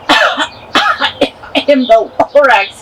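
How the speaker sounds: slow, hoarse little creature clearing its throat; coughing Lorax voice. Acted. The Lorax introducing himself to the Onceler, slow and coughing. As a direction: croak it out slowly between coughs. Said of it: "he coughed and he whiffed".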